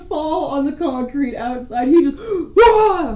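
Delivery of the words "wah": high, loud startled cry; startled yelp. Retold or imitated. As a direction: yelp like you got jumped. Friend startled by jump scare.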